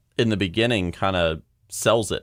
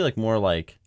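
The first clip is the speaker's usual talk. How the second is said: ordinary delivery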